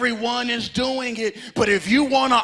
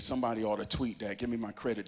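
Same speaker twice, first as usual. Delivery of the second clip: ordinary talk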